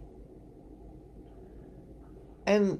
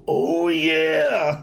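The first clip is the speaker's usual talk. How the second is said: Macho Man fan; 3 semitones higher, 5 dB louder than usual. Retold or imitated. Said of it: "And he goes".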